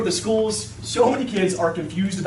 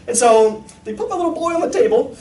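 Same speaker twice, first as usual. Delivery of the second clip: usual speaking voice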